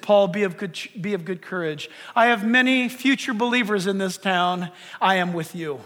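low, soft voice of a comforting Lord; calm reassurance. Retold or imitated. The Lord speaking to Paul to give him courage, calm and low.

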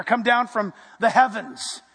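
normal talking voice

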